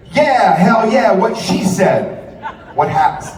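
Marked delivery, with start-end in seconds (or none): 0.1-2.2 drawn-out, high, loud hell yeah; slow cheering crowd voice, higher and louder; mock crowd cheer. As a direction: ham up the cheering crowd slowly. Mocking crowd cheering agreement.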